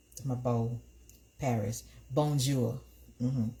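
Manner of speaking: ordinary talk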